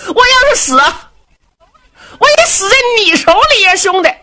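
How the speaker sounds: desperate fat Du Cha. Acted. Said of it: "杜查是真急了".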